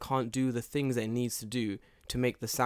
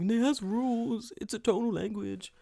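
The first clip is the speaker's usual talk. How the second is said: exasperated linguist; 10 semitones higher than usual. Acted. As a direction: wail it like an outraged expert. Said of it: "linguistics right now are tearing their hair out".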